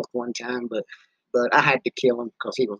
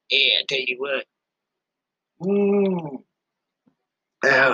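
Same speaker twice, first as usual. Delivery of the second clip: ordinary talk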